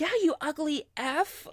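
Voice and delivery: mock scream. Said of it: "completely screaming at him".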